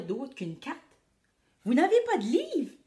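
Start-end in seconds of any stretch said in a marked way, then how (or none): 1.6-2.7 asking. A narrator's reading. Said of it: "ai-je demandé".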